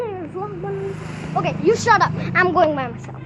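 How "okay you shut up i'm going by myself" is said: loud, annoyed shut up; loud telling off. Real, not acted. Kid telling his companion to shut up, loud annoyed. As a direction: snap at them and storm off.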